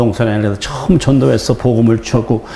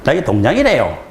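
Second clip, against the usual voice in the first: lightly voiced Gyeongsang village head, falling on 동장이래요; a hint of a Gyeongsang official introducing himself; 경상도 사투리로 무뚝뚝하게; Gyeongsang dialect. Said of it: "이제 네가 아니고 그건 동이더라요".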